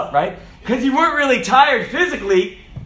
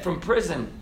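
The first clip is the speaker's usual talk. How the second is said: "from prison" dropped low and soft; quiet repeat; 4 semitones lower, 9 dB quieter than usual. Real, not acted. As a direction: let "from prison" sink in softly. Hushed repeat of same phrase.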